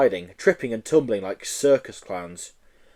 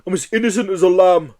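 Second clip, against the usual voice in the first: loud high indignant retort; 7 semitones higher, 6 dB louder than usual. Acted. Yeti retorting indignantly to Joe, loud high retort. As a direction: fire it back offended.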